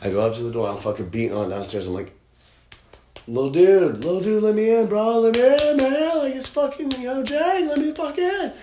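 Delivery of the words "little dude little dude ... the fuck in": very high, strained mock screaming; desperate yelling. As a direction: yell frantically to be let in. Retold yelling at a locked door.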